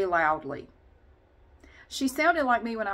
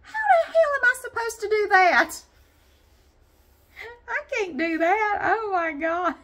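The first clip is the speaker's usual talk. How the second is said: much higher and louder, laughing through it; mock panicked self; 13 semitones higher, 8 dB louder than usual. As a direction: replay her own first-class panic. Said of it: "I remember thinking this stuff".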